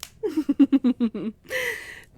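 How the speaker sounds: rising playful laugh; delighted vampire laugh; amused laugh. Acted.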